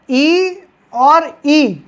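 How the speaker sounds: slow teacher demo of vowels; drawn-out vowels. Shown as a demonstration. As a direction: stretch each vowel very slowly. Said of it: "का उच्चारण कहाँ से आएगा".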